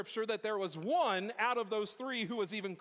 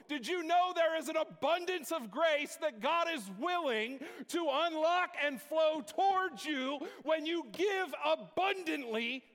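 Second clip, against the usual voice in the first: preaching at full force; 8 semitones higher than usual. Acted. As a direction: drive the congregation up to a climax. Call and response preaching.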